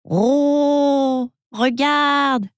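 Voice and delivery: high, eager exclamation; excited cry. A narrator's reading. Mochu spots a string and cries out, high and excited. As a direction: call out a sudden discovery. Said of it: "s'écrie-t-il".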